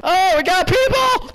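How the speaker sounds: high-pitched slow alarm; a sudden high yelp spotting enemies; excited alarm. Real, not acted. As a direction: cry out that enemies are near.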